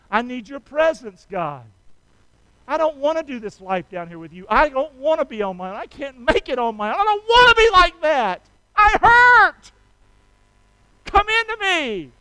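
climbs high and loud into a cry; praying in pain for God's presence; pleading prayer. Acted. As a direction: plead with God, almost crying out.

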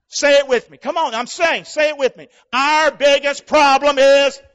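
loud, high preaching call; rally the crowd to repeat; fiery preaching. Acted.

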